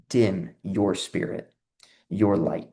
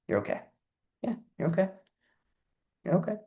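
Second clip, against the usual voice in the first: gentle soothing voice; 7 semitones higher, 7 dB quieter, much faster than usual. Acted. Heart voiced as soothing inner voice.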